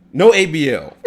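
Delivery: sudden high-pitched burst of laughter. Real, not acted. High amused laugh mid-rant.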